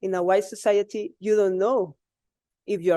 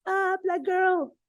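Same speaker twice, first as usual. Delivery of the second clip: mocking schoolboy; 11 semitones higher, slower than usual. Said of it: "he told me".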